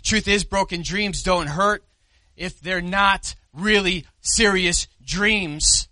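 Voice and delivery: ordinary talk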